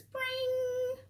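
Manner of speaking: high, quick imitated phone ring. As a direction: chirp it like a ringing phone. Phone rings in the story.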